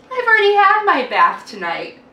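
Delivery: put-on high, loud little boy; high cheerful boy's voice; child voice. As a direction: pipe up like a cheeky kid. Walter voiced in the story.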